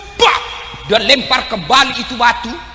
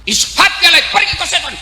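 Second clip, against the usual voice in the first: angry shout; 8 semitones higher than usual. Acted. Ibrahim yells at the devil to go away, angry shout. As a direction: drive the tempter off with a furious yell.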